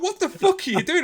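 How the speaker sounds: very high, loud mock outrage; disbelieving exclamation. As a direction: exclaim it in playful shock. Disbelief at singer's youthful look.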